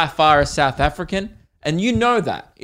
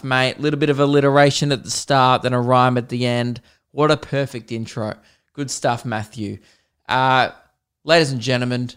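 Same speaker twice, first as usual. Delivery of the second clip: ordinary talk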